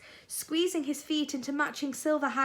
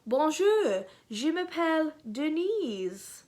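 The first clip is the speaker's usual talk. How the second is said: put-on higher, slow, playful French accent; 5 semitones higher, slower than usual. A narrator's reading. Dennis introduces himself as Denise in a French accent. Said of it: "If you say anything in a French accent".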